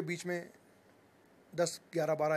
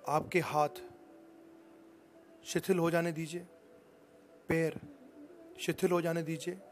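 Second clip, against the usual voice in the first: lulling instructor voice, a bit louder; guided meditation; 4 dB louder than usual. Acted. Leads a yoga nidra session.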